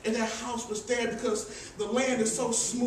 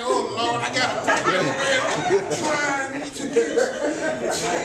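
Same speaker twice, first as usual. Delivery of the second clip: excited laughing shout; 7 dB louder, much slower than usual. Retold or imitated. Comic retelling of sinking porch.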